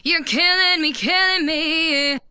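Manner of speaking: normal talking voice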